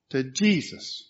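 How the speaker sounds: ordinary talk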